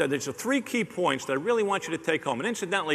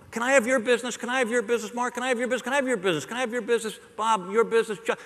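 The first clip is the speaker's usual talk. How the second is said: higher, insistent begging repeated to each name; pushy salesman pleading; 5 semitones higher, much faster than usual. Retold or imitated. Salesman pestering every prospect for business, mock needy pleading.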